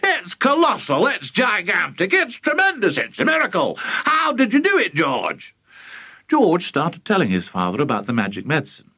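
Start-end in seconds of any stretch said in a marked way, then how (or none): 0.0-5.5 high, breathless raving; excited shouting. A narrator's reading. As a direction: gush in mounting wonder. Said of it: "Mister Crankie shouted".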